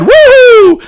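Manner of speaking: piercing high whoop of thrill; exultant whoop. Acted. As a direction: let out a wild cheer. Whoop after the kill.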